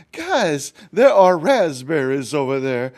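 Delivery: high hen voice calling out; eager hen. Acted. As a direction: announce the find to friends. Hen calls her friends.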